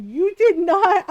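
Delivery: loud, slow, scandalized disbelief; retold disbelief. Reacting in disbelief to dad's confession.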